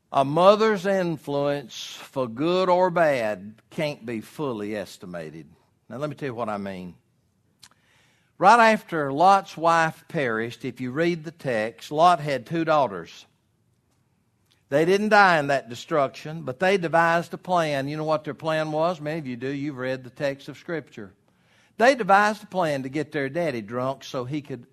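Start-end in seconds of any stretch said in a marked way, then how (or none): 0.1-5.5 slow, loud preaching emphasis; loud, emphatic. Shown as a demonstration. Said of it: "I'm gonna repeat it". 8.4-24.7 preaching. Acted. Preaching the text at full force.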